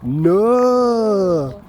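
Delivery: broad Yorkshire "No". Shown as a demonstration. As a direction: stretch the "No" the Yorkshire way. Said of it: "in Yorkshire people speak like that".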